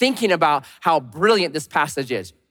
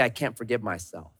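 quiet defeated; 6 semitones lower, 9 dB quieter than usual. Retold or imitated. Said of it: "the moment I say".

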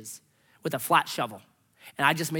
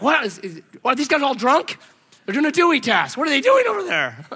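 mock police; 7 semitones higher, 8 dB louder than usual. Imagined police seeing church balance.

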